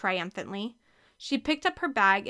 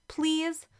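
higher, pleading; 6 semitones higher than usual. Acted. Ashley begging her sister, pleading. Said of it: "Ashley pleaded".

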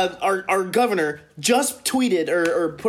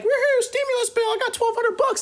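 much higher, mock celebrating voice; mocking cheer. Mocking city crowd cheering over stimulus checks, much higher. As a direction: gleefully cheer like a clueless crowd. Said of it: "everyone's like".

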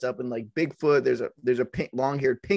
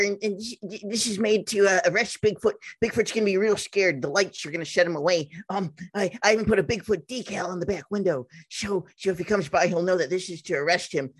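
stammering, earnest nerd impression; really nerdy guy. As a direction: play a proud nerd, tripping over the words. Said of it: "just really nerdy. He's like".

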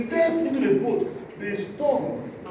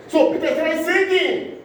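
preaching burst; 9 semitones higher, 5 dB louder than usual. Acted.

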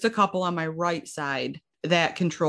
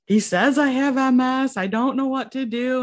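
high, tearful, louder, breaking voice; retold breakdown; 8 semitones higher, 4 dB louder than usual. Daughter telling her mom the diagnosis, tearful and high. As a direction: sob it out as if just breaking down. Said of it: "broke down".

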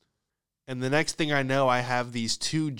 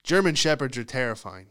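ordinary delivery

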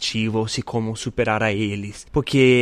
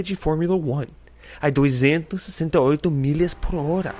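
high, bright fact-reading voice; announcer voice; 6 semitones higher than usual. Acted. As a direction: read it like a trivia announcer. Reads facts in another voice.

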